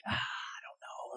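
nervous whisper; whispered doubt. Retold or imitated. Gideon whispering his doubts to God. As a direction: hush it like you're afraid to say it.

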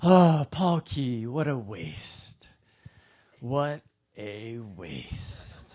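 slow, drawn-out mock pity; the whole town pitying a fallen chef, slowly; pitying sigh. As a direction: shake your head over him slowly. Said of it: "all of us would go".

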